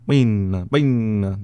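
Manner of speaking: FINNA loud, with a Finnish accent. Shown as a demonstration. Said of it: "And you do that".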